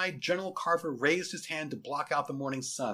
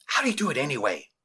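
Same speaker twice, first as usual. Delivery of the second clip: quick, louder, eager question; giddy boy; 6 dB louder, faster than usual. Acted. Boy asking his teacher how she does it, giddy. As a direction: blurt it out with childish curiosity. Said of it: "asked the boy with the giddiness".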